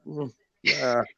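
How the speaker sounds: ordinary talk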